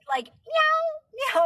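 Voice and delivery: high squeaky meow; cat meow. Retold or imitated. Mimicking her cat meowing in her face, high meow. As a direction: mew it like a demanding cat.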